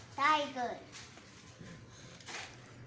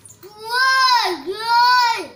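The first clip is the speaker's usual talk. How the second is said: louder, stretched-out naming; loud drawn-out call; 3 semitones higher, 10 dB louder than usual. Real, not acted. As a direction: call the word out with gusto. Child shouting the card.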